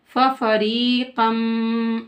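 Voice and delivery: फफरीक़न slow, ending in a held nasal hum; ikhfa. Shown as a demonstration. Teacher showing hidden tanween, humming end of फफरीक़न. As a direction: chant फफरीक़न with long ee and hidden n. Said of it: "इख्फा हो रहा है".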